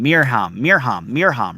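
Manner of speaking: Mirham chanted as MEER-hahm, evenly repeated; singsong MEER-hahm for each Mirham; practising a pronunciation. Shown as a demonstration. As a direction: repeat Mirham in the same up-and-down swing. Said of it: "tell me how to pronounce your name".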